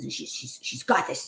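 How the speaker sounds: normal talking voice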